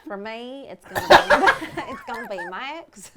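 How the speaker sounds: put-on Texas accent. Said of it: "that is our awful accent".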